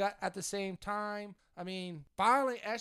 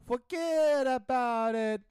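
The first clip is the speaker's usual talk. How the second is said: higher, louder, drawn-out Italian-American call; exaggerated Italian-American catchphrase; 4 semitones higher, 5 dB louder, much slower than usual. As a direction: belt it out slowly to needle him, Italian-American style. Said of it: "Italian hand gesture at the end".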